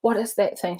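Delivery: mildly panicked imitation of a seatmate; imitated anxious lady. Frightened lady asking about the mantra, mock urgency. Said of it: "she was like".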